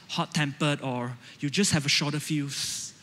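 normal talking voice

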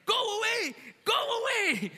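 high, loud frightened yell; shouting at the snake. Retold or imitated. Worker waving a broomstick at a snake, scared yell. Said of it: "start talking to the snake".

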